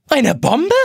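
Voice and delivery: high, shocked squeal of "Bombe"; shrieking alarm. A narrator's reading. All the egg timers shriek together in alarm. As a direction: squeal the word in panic. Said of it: "kreischen alle elektrischen Eieruhren".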